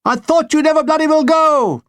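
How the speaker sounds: high, loud exasperated retort; fed-up relief at last. Retold or imitated.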